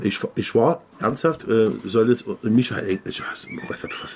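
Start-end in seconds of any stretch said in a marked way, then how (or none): 3.0-4.2 half-whispered self-catch; hushed aside. Real, not acted. Speaker who almost said a name, hushed aside. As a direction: drop to a near-whisper, catching yourself.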